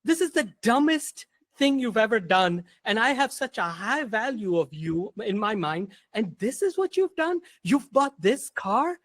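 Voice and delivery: mock the friend's disbelief at the purchase; lecturing friend. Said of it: "He's like".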